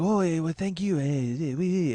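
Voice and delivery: mumbly mock-French brush-off; French accent. French guy walking off without paying, French accent. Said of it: "it was a French guy".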